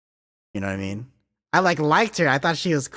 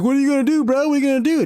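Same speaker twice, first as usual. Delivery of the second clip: mocking taunt; 12 semitones higher, 6 dB louder, much faster than usual. Bully taunting during snowball fight.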